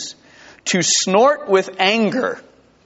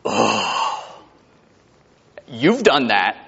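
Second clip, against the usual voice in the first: imitated groan; 6 semitones lower, much slower than usual. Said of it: "Jesus is going".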